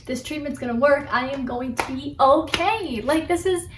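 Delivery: re-enacted giddy optimism, pitch climbing; happy, excited. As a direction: gush with relief at finally having an answer. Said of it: "leaving the doctor's office happy".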